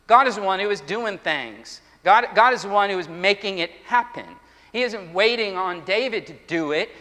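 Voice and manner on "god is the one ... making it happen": ordinary talk, nothing marked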